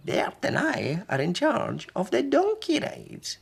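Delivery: put-on high, slow old granny voice; a cheerful little old lady; high sing-song granny; wee Granny.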